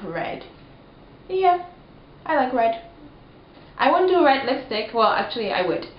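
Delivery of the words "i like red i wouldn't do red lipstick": ordinary talk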